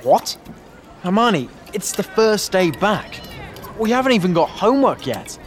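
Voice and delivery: disbelief at her hurry; incredulous Ron. A narrator's reading. Ron to Hermione rushing to the library, incredulous.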